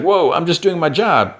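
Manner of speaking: high, flustered defence; high mock-flustered whine; startled protest. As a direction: protest while dodging. Owl protesting while fleeing.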